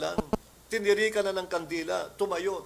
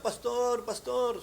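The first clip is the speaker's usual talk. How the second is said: mock calling out, higher pitched; retold shouting; 7 semitones higher, much slower than usual. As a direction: call out to the pastor in excitement. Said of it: "sigaw ng sigaw".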